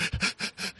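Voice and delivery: mimicked gasping sobs, short and quiet; imitated hyperventilating. Father imitating his crying son, mock hyperventilating. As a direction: imitate a crying child's quick shuddering breaths. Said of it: "that kind of hyperventilating".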